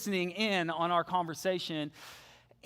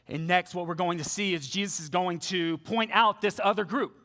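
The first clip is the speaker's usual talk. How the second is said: usual speaking voice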